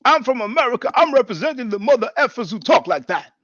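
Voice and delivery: high, loud parody of a debater; mocking imitation. Replayed boast mimicked in mockery.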